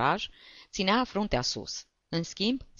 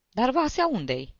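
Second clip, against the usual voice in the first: put-on higher, anxious young ladies; worried question; 8 semitones higher than usual. A narrator's reading. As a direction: ask with alarm, pitched up. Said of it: "se neliniștiră domnișoarele". (întrebare neliniștită)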